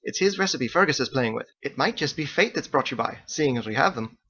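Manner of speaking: high, bright-toned Irish-style hostess; Margot's lilting voice. A narrator's reading. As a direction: play the chatty innkeeper woman, high.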